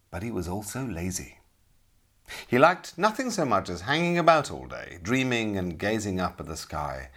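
storyteller voice for a legend; epic fantasy narrator. A narrator's reading. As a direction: tell it like an old legend. Storyteller begins the tale.

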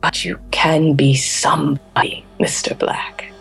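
a voiceless, intimate whisper; seductive whisper. Acted. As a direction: lean in close and tempt him. Queen seduces her bodyguard.

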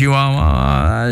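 very slow, drawn-out ua ua ua; lazy chanted ua; wild ua. Shown as a demonstration. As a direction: stretch each ua out, mock-wild. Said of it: "e mai pe sălbăticie așa".

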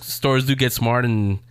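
ordinary talk, nothing marked

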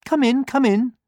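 very high, loud hen; hen character. A narrator's reading. Hen welcoming the pig at her door, calling out. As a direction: call them in with a high sing-out. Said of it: "said the hen".

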